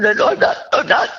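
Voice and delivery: high, retold tearful outburst; tearful protest. Crying wife bolting from the doctor's room, mock-distraught. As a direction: act out crying, pitch jumping up. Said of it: "and I am crying".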